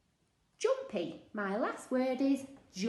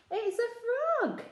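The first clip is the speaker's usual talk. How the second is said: high, slow, delighted answer; high, drawn-out teacher reveal; excited reveal; 14 semitones higher, 3 dB louder, slower than usual. Shown as a demonstration. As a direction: reveal it like a surprise. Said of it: "revealing the answer".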